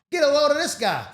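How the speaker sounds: loud, high-pitched mock jeer. Boss ridiculing the worker who just got himself fired, loud jeer. Acted jeering as man gets fired.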